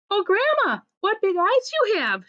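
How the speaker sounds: high, swooping storybook exclamation; mock amazement. A narrator's reading. Little Red to the disguised wolf, high feigned amazement.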